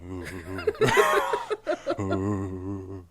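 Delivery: put-on "ooh" groans; imitated groaning. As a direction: groan along like someone queasy with the shakes. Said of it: "She's just sitting there like".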